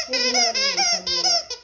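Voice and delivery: high, fast, laughing. Real, not acted. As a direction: laugh through the words. Laughing aside.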